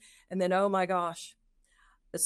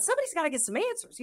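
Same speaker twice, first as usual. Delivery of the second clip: much higher and fast, fed-up decision; determined resolve; 11 semitones higher, much faster than usual. Retold or imitated. Recalling her decision to certify, high and fast. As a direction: blurt it like you've had enough. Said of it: "So I thought".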